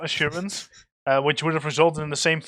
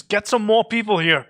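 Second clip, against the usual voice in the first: raised, urgent in-character shout; 6 semitones higher, 5 dB louder than usual. Acted. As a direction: call out loud and higher for more help. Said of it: "I just shout at Lucius".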